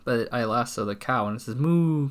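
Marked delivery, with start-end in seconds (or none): 1.5-2.1 louder, higher, drawn-out moo; cow moo. Acted. The lassoed cow answers the cowboy, drawn-out moo. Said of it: "'cause that's what cows say".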